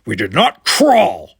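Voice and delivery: slow, loud, furious denial; boiling fury. A narrator's reading. Worf rejects the slur, furious. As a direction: let fury burst out, high and drawn out. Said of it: "fury bo-, boiling over".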